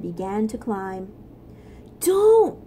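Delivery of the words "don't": sharp high cry of warning; a desperate high shout to stop a friend. Acted. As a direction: yell a frantic "don't". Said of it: "cried Floyd".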